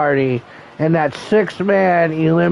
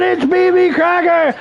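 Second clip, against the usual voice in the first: hyped shout; 9 semitones higher than usual. Pretend. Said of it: "entrance call".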